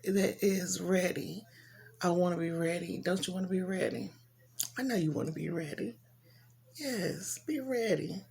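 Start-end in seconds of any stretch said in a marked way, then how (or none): 2.0-8.2 soft, fast, choked-up pleading; holding back tears while urging them. Real, not acted. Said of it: "I get a little emotional".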